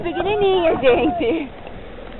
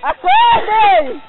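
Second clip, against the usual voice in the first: high drawn-out yell to wake the dead; loud wake-up shout; 4 dB louder, much slower than usual. Acted. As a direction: bellow it slowly so everyone wakes. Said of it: "acorda os mortos". (grito alto e arrastado)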